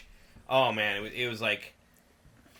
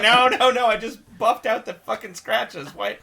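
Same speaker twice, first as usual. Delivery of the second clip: loud, high exasperated protest; mock outrage; 9 semitones higher, 7 dB louder than usual. Retelling the protest to Maxie over scratches, mock outrage. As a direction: wail in hammed-up dismay.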